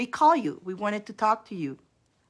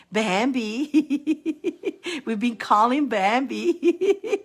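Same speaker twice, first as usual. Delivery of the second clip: higher, drawn-out name breaking into laughter; laughing call; 4 semitones higher, 4 dB louder, much slower than usual. Real, not acted. Host calling for Bambi in the live, laughing. Teasing call to missing viewer.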